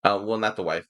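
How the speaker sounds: ordinary delivery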